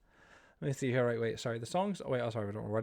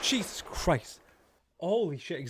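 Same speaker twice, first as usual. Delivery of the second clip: sudden loud, higher shout of fright; startled cry; 5 semitones higher, 4 dB louder, much slower than usual. Real, not acted. Streamer jolted by a jump scare, crying out loud. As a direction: blurt it out in real shock.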